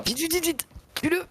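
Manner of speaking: hurry, hurry, high and frantic. Real, not acted. Urging teammate under fire.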